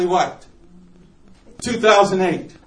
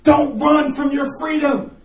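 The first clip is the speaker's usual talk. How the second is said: commanding; 6 semitones higher, slower than usual. Retold or imitated. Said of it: "and I said".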